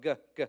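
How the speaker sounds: "g, g" as a hard g like in go; hard G sound. Shown as a demonstration. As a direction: clip out a hard "g" twice. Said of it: "It's a hard G sound".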